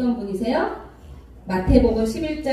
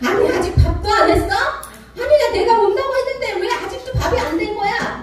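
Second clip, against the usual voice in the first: high, louder mock grumbling husband; hammed-up annoyed nagging; acted irritation; 10 semitones higher, 3 dB louder than usual. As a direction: play a hungry husband complaining as he walks in. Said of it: "남편이 막 짜증을 내요".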